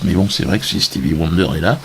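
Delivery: ordinary delivery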